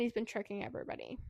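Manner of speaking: whispered fast, like letting out a secret; hushed, fast confiding aside. Real, not acted. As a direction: lean in and whisper it quickly. Revealing a secret in the story.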